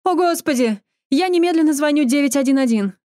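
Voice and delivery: high alarmed voice calling for help; panicked cry. A narrator's reading. Bystander at a car crash, panicked and loud. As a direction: gasp it out in shock at the crash.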